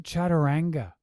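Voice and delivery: Chaturanga with flat "a" as in "cat"; mispronounced Chaturanga. Shown as a demonstration. Critic questioning yoga teachers' Sanskrit, Chaturanga with "a" as in "cat". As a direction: say Chaturanga as "chat-a-RANG-ga". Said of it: "there's no ah in Sanskrit".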